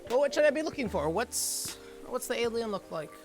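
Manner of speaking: high, quick curious voice; high eager character voice. Acted.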